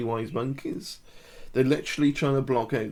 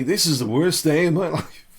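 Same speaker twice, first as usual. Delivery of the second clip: higher, louder cheer breaking into a laugh; upright, upbeat; 5 semitones higher, 5 dB louder than usual. Shown as a demonstration. Coach showing viewers an upright posture that contradicts the words, upbeat. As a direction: proclaim it head up, laughing at the contradiction. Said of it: "Head up".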